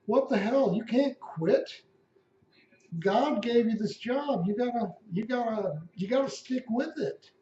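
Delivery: jaw-dropped cardinals begging him not to quit; shocked cardinals. Retold or imitated. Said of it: "They're like".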